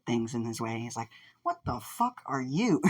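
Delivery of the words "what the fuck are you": high, slow, incredulous question; appalled disbelief. Acted. Fighter facing a weird bird creature, high incredulous voice. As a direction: pitch it up and draw it out, aghast.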